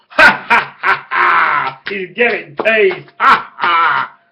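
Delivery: high, loud cackling laugh shouted out; mock maniacal laugh. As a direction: laugh like a loon who can't stop. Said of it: "which made his laugh stronger, like this".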